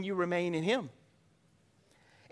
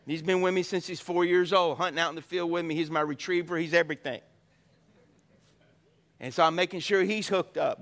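ordinary delivery

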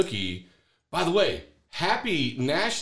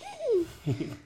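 high giggle; 5 dB quieter than usual. Real, not acted. Laughs after fish voice.

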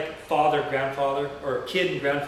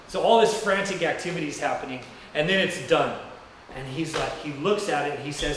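ordinary delivery

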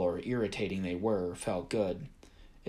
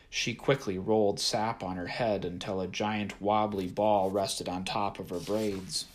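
plain reading voice

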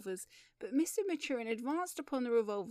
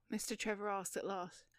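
ordinary delivery, nothing marked

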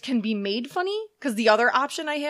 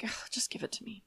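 whispered, much softer retort; mock sigh it under the breath; exasperated aside; 13 dB quieter than usual. Host retelling her reply to forecasters, exasperated whisper.

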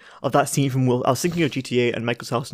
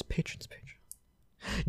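soft, slow whisper; whispered repeat; 16 dB quieter, much slower than usual. Pretend. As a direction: whisper the word twice. Whispered repetition.

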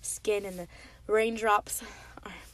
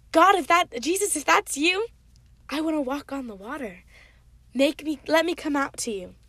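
Peter calling out higher and louder, full of faith; eager call; 4 semitones higher, 7 dB louder than usual. Acted. Peter begs Jesus to let him walk, eager loud call. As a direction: shout it across the water, bursting to go.

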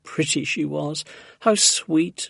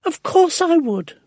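very high, loud exclamation; eager cry; 12 semitones higher, 6 dB louder than usual. A narrator's reading. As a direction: cry out your eager agreement. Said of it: "Missus Silver cried".

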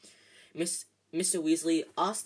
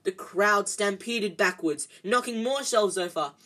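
plain reading voice

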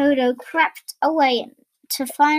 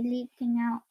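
ordinary delivery, nothing marked

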